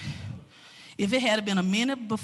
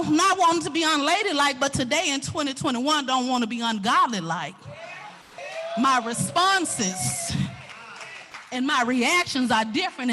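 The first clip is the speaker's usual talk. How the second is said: ordinary delivery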